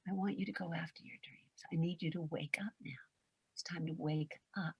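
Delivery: hushed whisper, coaxing them awake; soft wake-up whisper. Acted. Coach whispering to sleeping listeners, gentle whisper.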